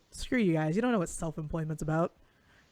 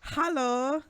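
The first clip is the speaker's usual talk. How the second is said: bad Russian accent; 4 semitones higher, 4 dB quieter, slower than usual. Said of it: "That was really bad Russian".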